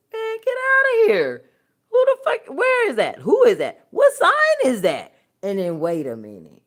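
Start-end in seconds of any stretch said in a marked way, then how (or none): 0.1-5.1 loud, high, outraged disbelief; outraged shout. Real, not acted. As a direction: yell it out in total disbelief. Outraged rant at new sign.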